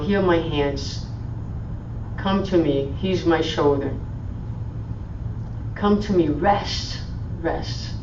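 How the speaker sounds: soft, tender voice offering comfort; loving invitation. Acted. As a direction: hold out your arms with your voice, softly. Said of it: "What a loving invitation".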